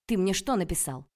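loud, indignant reproach; angry scolding. Acted. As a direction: tear into him. Heroine scolding Petya. (громкий упрёк)

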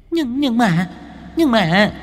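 high, hesitant stammering ghost; frightened stammer. A narrator's reading. The student ghost balks at Hải Nghẹo, high and stammering. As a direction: balk in a thin high voice. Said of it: "lắc đầu quẩy quậy".

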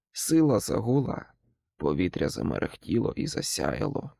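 plain reading voice

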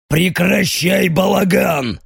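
slow, angry growled shout. Acted. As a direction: bark the order, growling. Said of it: "рыкает Алехандро".

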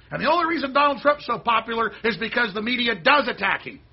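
furious rant aimed at the mainstream media; angry tirade. Real, not acted. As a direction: hurl it at the media.

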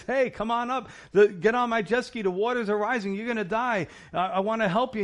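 a rescuer shouting an offer over the water; urgent mock call. Jet ski rescuer calls up to the man on the roof, loud and high.

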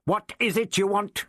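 high, sharp storming demand; the Doctor confronts the Zarbi, storming; stormed demand. A narrator's reading. The Doctor blocks the Zarbi's way, storming at it. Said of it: "he stormed".

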